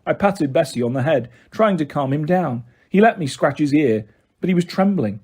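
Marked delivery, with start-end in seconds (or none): none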